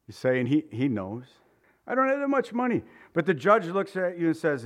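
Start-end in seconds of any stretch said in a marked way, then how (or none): 1.9-2.8 high desperate plea, close to crying; voice breaking. Pretend. Son begging his father in court, voice cracking. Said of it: "Your voice breaks and you're ready to cry".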